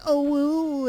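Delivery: mocking squeaky girly anime voice; high cute anime girl. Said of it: "like, going".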